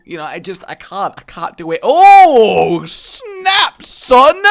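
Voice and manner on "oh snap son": excited shout. Real, not acted. Excited shout at game item.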